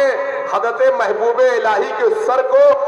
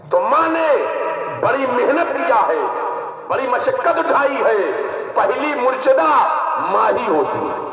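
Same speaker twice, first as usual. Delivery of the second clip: forceful preaching; 5 semitones higher than usual. Acted. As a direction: drive the lesson home to the crowd. Preaching climax about mother. (پرجوش خطیبانہ انداز)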